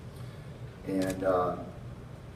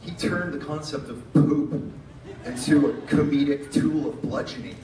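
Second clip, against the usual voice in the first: laughing while talking; 7 semitones higher than usual. Real, not acted. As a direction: laugh through the memory. Laughing recalling friend's humor.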